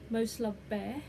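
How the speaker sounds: ordinary delivery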